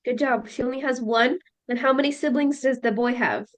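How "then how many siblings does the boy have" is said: usual speaking voice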